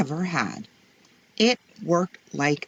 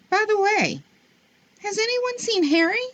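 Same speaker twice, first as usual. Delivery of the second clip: high family voice. A narrator's reading. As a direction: voice jumps very high, wondering. Family member asks about Harry.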